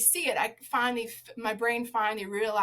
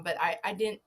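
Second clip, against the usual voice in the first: ordinary talk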